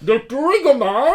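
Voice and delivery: loud, high, drawn-out masked villain; over-the-top garbled villain bellow; muffled Bane voice. Acted. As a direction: shout slowly through your hand. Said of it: "quand tu mets ta main".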